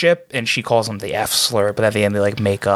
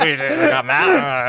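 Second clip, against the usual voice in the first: high, drawn-out mock whine; overblown, silly version of getting mad; mock angry imitation; 12 semitones higher, much slower than usual. Friends joking about who really got mad, high mock outrage.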